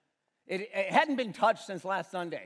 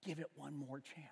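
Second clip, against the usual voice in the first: hushed phrase; 5 semitones lower, 14 dB quieter than usual. Real, not acted. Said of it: "the phrase that really gets me".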